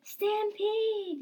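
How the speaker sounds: high yell, stretched out at the end; loud, high-pitched panicky yell; yelled warning. A narrator's reading. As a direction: cry out a drawn-out alarm. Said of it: "yelled Caddy and Spade".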